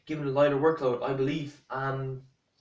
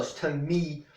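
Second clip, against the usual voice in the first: ordinary talk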